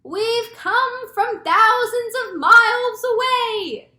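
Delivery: very high, loud, drawn-out holler; long-carrying high-pitched call; shouting back. A narrator's reading. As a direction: shout down from a great height. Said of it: "the centipede shouted back".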